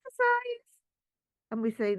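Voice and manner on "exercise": body's cue. Pretend. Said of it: "the body says".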